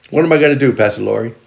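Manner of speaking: ordinary delivery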